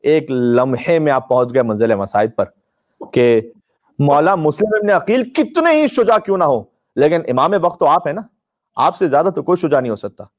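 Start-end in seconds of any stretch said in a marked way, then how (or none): none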